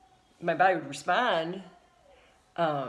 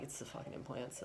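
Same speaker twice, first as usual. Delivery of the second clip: whispered under the breath; gut-feeling whisper; 4 semitones lower, 14 dB quieter than usual. Retold or imitated. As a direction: whisper it like a private certainty. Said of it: "but in my gut".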